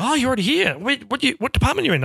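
high-pitched, curious mock surprise; surprised questioning. Others learning he is already on the lot, surprised questioning. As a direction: imitate people amazed to find you there. Others' reaction to his job, retold.

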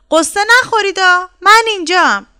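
high, loud little voice calling out; little storybook hero, pitched high and loud; tiny child piping up. A narrator's reading. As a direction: call from hiding to reassure them. Hidden little one pipes up.

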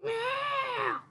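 very high, loud meowing cat voice; high yowling house cat; whiny cat voice. Retold or imitated. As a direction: wail at the door like a demanding cat. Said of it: "I hear".

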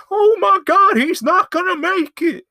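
very high, loud hammed-up shriek; panicked mock scream. Stunt coordinator watching Johnny's jump fail, shrieking in mock panic. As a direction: squeal it out in exaggerated horror.

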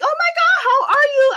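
high, loud excited hello; excited greeting. Retold or imitated. Greeting her hamster in the morning, high loud excited. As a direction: call out high and bright in greeting.

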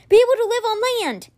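high, urgent shouted spell; desperate shout. A narrator's reading. Boy shouts a spell to save the whale, desperate. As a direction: yell the fix before it's too late. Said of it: "he shouted".

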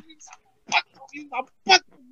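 very high, softening comic wail; mock lament. Simplicio in church mourning his shoes, mock lament.

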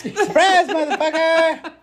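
high laughing yell; laughing shout. Acted. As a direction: squeal it while cracking up. Shouting surprise line, laughing.

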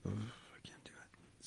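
breathless disgusted whisper; groaning whisper. Retold or imitated. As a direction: groan it out under your breath. Imitating a night person's reaction.

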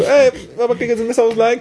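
put-on high, sweet little-kid voice; innocent kid asking. Boy asking his dad to borrow a knife, high and innocent.

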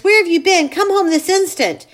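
loud, high relieved cry; frantic mother calling. Acted. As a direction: call out to her lost son. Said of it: "his mother crying".

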